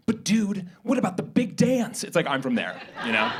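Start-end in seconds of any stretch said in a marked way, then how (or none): 0.1-2.0 put-on higher-pitched teen movie character; high school movie character. Comedian quoting a cheesy high school movie, mock-dramatic teen. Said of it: "one character says something like".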